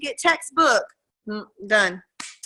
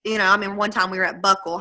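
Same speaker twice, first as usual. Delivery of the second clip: ordinary delivery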